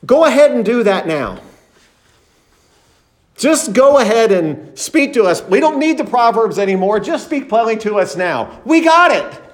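brash know-it-all pitched well up; cocky self-confidence. Retold or imitated. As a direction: play them as sure they've got it, irreverently. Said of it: "a demonstration of the self-confidence".

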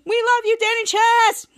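high, loud mock fan chorus; hammed-up group shout, last word held; fans in unison. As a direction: chant it out like adoring fans. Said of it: "in unison".

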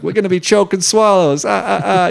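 high, goofy pun with a cackle; corny comedian voice. Acted. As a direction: sell the bad pun like a hack comic. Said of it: "the only joke that Choke gets to make".

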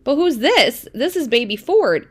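dad flipping the card, mock puzzled. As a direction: play the bewildered father. Dad reads card, retold.

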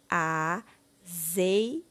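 AZEITE broken into a, zei, te with long gaps; syllable by syllable. Shown as a demonstration. Teacher giving the word for the bottle picture, syllable by syllable. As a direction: sound out AZEITE one syllable at a time. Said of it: "Iremos colocar a palavra".

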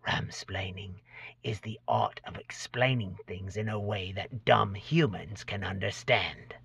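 soft hissed goblin whisper; hissing whisper. Acted. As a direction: hiss the secret word quietly. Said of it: "whispers in a hissing voice".

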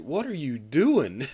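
mock outraged question; indignant. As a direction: demand an explanation. Victim confronts the trainee.

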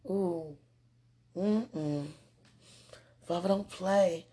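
normal talking voice

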